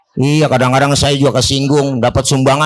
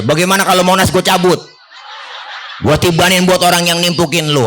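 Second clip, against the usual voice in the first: angel's offer; 6 semitones higher, faster than usual. Pretend. Angel Jibril offers revenge.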